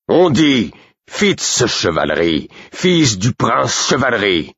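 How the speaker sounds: high, slow, emphatic indignation; outraged correction. A narrator's reading. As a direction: correct the man, drawing out each name in outrage. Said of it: "Burick aussi outragé". (correction outragée, haute et martelée)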